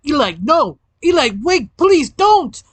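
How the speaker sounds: high, climbing cries of protest; acted panicked pleading; desperate plea. A narrator's reading. Parasite desperately pleads with Eli.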